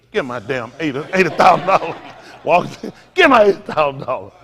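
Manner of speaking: loud hammed-up threat; mock robber demand. Preacher playing a gunman demanding his casino money, loud mock shout. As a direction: bark it like a stick-up man at the door.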